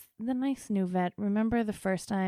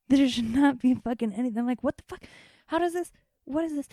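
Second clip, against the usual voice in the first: high, agitated disbelief; raised-pitch, flustered outburst; retold bewildered shock; 6 semitones higher, 5 dB louder, faster than usual. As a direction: demand answers in baffled alarm. Retold shock at abnormal test result.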